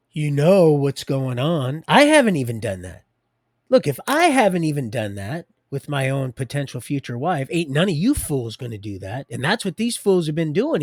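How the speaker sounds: loud, forceful scolding; loud rant. Real, not acted. Preacher scolding the fools who accuse him, loud and heated. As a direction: raise the voice and scold. Ranting at fools, much louder.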